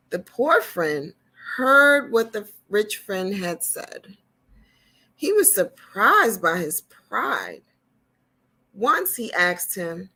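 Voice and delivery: put-on slow, clear read-aloud for children; gentle storyteller reading to little ones; storybook reading. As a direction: read it like a picture book to kids.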